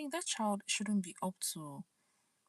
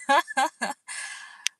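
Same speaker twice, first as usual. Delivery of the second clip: loud, high burst of laughter; big amused cackle; real laugh; 8 semitones higher, 11 dB louder than usual. Real, not acted. Amused by her childhood memory.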